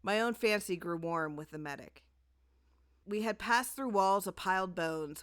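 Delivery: usual speaking voice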